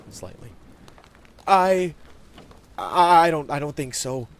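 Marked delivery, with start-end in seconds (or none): 1.5-4.3 loud stammer, easing down; high kid voice, caught out and hesitant; reluctant admission. A narrator's reading. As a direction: admit it haltingly as a young boy. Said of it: "he admitted".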